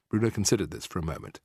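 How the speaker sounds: usual speaking voice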